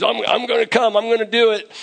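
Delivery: high-pitched, eager insistence; acting an aging leader keen to join in; fired up. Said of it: "He's fired up".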